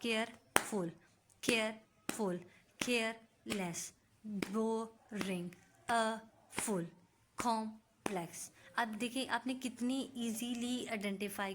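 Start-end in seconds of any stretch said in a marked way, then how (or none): 0.0-8.5 केयरफुल as "केयर... फुल", a clear gap between syllables; slow syllable-by-syllable teacher demo, कॉम्प्लेक्स as "कॉम... प्लेक्स"; split into syllables. Shown as a demonstration. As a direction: tap out each word in two separate, falling beats. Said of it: "मैं tap करती हूँ ताकि आपको आवाज़ भी आए".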